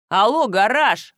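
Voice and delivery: loud, drawn-out, irritated call; mildly angry. A narrator's reading. Husband challenging his jealous wife, with mild anger. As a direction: snap him awake, slow and loud. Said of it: "разозлился Евгений".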